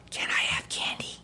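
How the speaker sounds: imitating a secretive little girl whispering; hushed, voiceless plea for candy; child's whisper. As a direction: whisper it, sneaking a request. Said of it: "I guess she's whispering".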